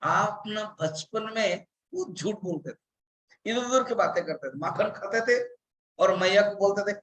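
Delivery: ordinary talk, nothing marked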